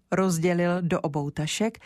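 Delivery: ordinary delivery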